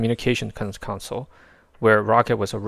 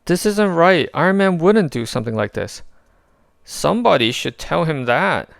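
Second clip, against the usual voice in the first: higher, louder worried voices; urgent battle talk; 7 semitones higher, 5 dB louder than usual. A narrator's reading. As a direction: shout over the fight.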